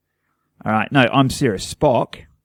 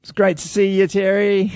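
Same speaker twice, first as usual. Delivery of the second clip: re-enacted greeting; 9 semitones higher than usual. Fan greets author at book signing, high-pitched re-enacted greeting. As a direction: call it out high, at normal volume.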